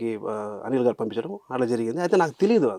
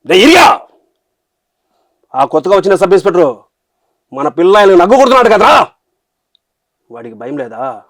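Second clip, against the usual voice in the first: roaring Anantapur-slang villain threat, much louder and higher; cruel Anantapur villain dialogue; 6 semitones higher, 14 dB louder than usual. Shown as a demonstration. Actor performs the audition dialogue for the interviewer, roaring Anantapur villain. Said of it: "ఒకసారి చెప్పండి ఆ dialogue".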